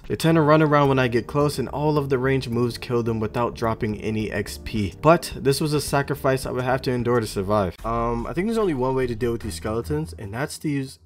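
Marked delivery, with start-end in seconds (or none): 0.1-7.8 loud, energetic recap voiceover; voiceover narration. Acted.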